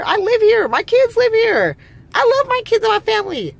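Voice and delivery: very high, exasperated pleading of your case; indignant protest. Real, not acted. Protesting being called a terrorist.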